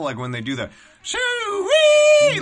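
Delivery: high, loud, slow farm holler; pig call. Retold or imitated. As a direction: call the pigs in from the field. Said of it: "the pig call".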